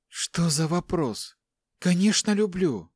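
high-pitched woman's voice; distressed mother. A narrator's reading. Captive mother insists she loves her children, distressed. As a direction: answer high, as an anxious mother.

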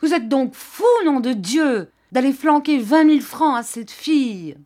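high, loud outraged yelling at the in-laws; angry shout. A narrator's reading. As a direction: hurl it at them from the doorway. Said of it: "il cria vers ses beaux-parents".

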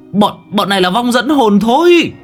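calling out. Acted. Young master shouting to the monks to spare the ghost children. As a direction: cry out to stop the slaughter. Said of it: "Cậu kêu lên".

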